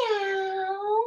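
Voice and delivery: put-on cute cat meow for children; mock kitty meow. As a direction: mew like a little kitten. Cat pose animal sound.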